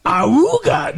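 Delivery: loud laughing ahooga honk; ahooga horn. Retold or imitated. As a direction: honk it out like an old car horn. Imitating Tim Allen's signature grunt.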